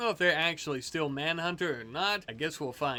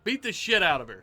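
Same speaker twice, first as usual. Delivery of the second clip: normal talking voice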